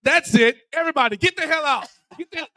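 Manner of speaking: a touch of a furious coach clearing the gym; mock angry coach. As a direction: play the coach throwing everyone out. Coach kicking everyone out.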